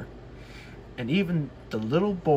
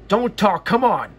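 louder, snappy retort; impatient; 5 semitones higher, 6 dB louder than usual. Acted. James snapping at the grumbling coaches, impatient. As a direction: cut them off and push on. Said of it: "answered James".

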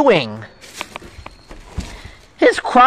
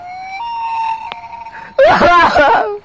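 very high drawn-out bawling; hammed-up wail that bursts at the end; powerful cry. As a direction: let out a long, piercing pretend sob. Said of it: "pushing people with that cry".

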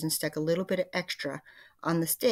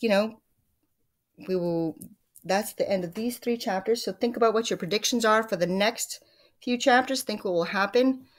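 plain reading voice

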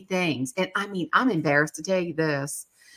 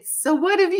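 sheepish question; 10 semitones higher than usual. Retold or imitated. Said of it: "you know, sheepish".

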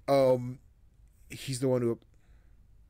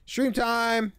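excited; 11 semitones higher, 6 dB louder than usual. Real, not acted. Streamer opens the stream.

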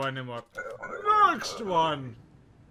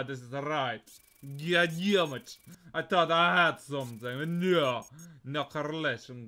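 put-on Russian accent. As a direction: play a border official with a Russian accent. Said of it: "where's my Russian accent?".

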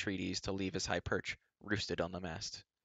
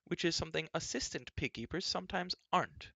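high, pointed princess voice; princess's jab; 9 semitones higher than usual. A narrator's reading. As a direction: needle him in a high girlish voice. Princess's pointed remark continues.

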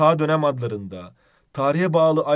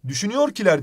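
"Düşünüyorkiler" said higher and louder; test word called out; 6 semitones higher, 4 dB louder, faster than usual. Shown as a demonstration. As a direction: try out "Düşünüyorkiler" aloud. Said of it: "diyebiliyor muyuz?". (denenen kelimeyi yüksek sesle söyleme)

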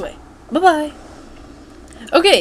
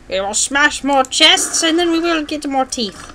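put-on Alice voice, pitched higher; drastically changed voice; 4 semitones higher than usual. Player answers himself as Alice, drastically changed voice. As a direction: play Alice in an obviously altered voice. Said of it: "Alice, when did you change your voice so drastically?".

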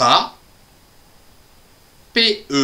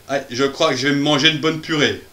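quick playful aside; much faster than usual. Pretend.

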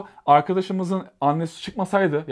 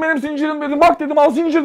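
exasperated yell; 10 semitones higher, 6 dB louder than usual. Retold or imitated. Narrator insists chain is his.

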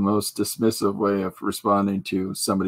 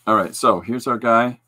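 ordinary talk

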